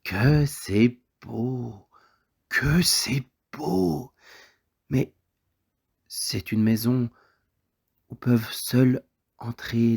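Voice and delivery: put-on drawn-out, lifted child's marvelling; little boy's wonder. A narrator's reading. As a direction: marvel very slowly, like a little boy. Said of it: "Les yeux du petit garçon".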